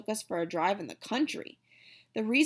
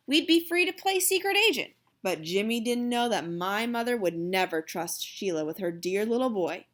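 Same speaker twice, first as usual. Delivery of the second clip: plain reading voice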